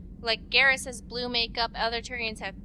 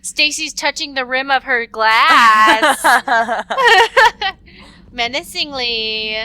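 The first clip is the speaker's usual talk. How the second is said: laughing; 3 semitones higher, 14 dB louder, slower than usual. Real, not acted. Mock-dramatic narration while laughing.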